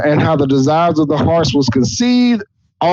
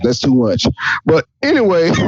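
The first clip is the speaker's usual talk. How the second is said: ordinary talk, nothing marked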